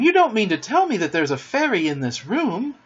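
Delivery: high-pitched amazed question; crying out. A narrator's reading. Wendy learning a fairy is in the room, amazed cry. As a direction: ask it in thrilled disbelief. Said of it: "she cried, clutching him".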